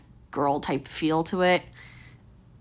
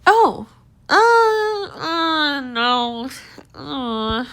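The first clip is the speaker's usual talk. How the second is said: dismayed distaste; 7 semitones higher, much slower than usual. Real, not acted. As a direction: recoil with a drawn-out, pained "no". Recoils at a dress she dislikes.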